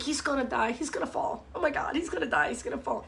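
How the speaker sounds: soft, half-whispered fretting; hushed worry. Retold or imitated. Watching the squirrel on the wire, hushed fearful fretting. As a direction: breathe it out as if afraid to jinx it. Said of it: "and I'm like".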